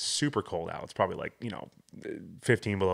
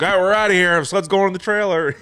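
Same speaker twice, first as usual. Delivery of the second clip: much higher and louder, done with it; fed-up announcement; 11 semitones higher, 10 dB louder than usual. Retold or imitated. Dad storming in to tell the boys they are leaving, loud. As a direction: announce it loudly, giving up.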